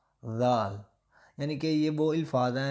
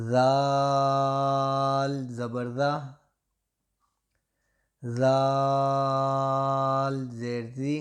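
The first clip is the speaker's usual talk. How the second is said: very slow, louder sing-song drill; spelling drill; 3 dB louder, much slower than usual. Shown as a demonstration. Teacher leading a child's spelling chant, very slow and loud. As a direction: drag each syllable out for the class to repeat. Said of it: "میں آپ کو یہ جوڑوں کے ساتھ ہی پڑھاؤں گا".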